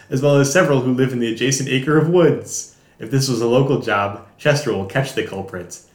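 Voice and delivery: dramatic teaser voiceover, lightly done; movie trailer narration. Acted. Reads mock movie teaser.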